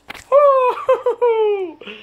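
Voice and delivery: real high laugh; high burst of laughter. Real, not acted. Laughing at his own help wanted joke. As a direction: crack up at the joke.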